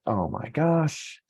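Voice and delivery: mock lament, pitched well up and dragged out; hammed-up dismay at lost years; regretful self-talk. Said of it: "you're saying things like".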